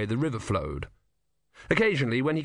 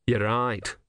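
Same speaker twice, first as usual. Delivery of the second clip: usual speaking voice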